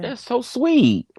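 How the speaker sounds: high cooing. Real, not acted. Cooing reply to confession.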